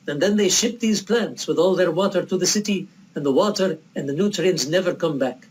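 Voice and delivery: old-movie Arabic accent, as Alec Guinness played it. Bedouin man lecturing the vegan guest, in an Arabic accent. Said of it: "Apologize for the accent".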